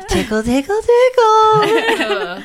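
creepy sing-song tickle with a laugh; giggly tickle attack. Acted.

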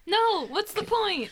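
high, loud wail of protest; mock despair. Player protesting the lost smell of coffee, mock despair. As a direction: cry out in hammed-up despair.

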